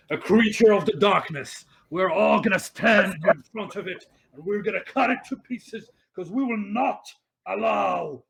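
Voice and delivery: rally the troops in earnest, pitched high and loud, easing at the end; very serious tone. Acted. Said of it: "he took a very serious tone".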